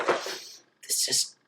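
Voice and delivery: strained, mounting mock shock; high, loud, drawn-out acted disbelief; shocked realization. Whole class realizing the parallel, shocked realization.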